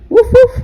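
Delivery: put-on high, loud woof woof for the child; playful dog bark. Shown as a demonstration. Mom barking for toddler learning animal sounds. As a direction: bark it like a cartoon puppy. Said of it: "How about the dog?".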